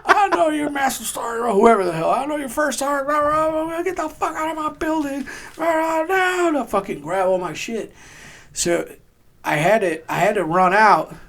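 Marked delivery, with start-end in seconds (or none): 0.1-6.6 high mock rant, laughing through it; angry sergeant. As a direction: rattle off the chewing-out in a high voice. Sergeant chewing him out.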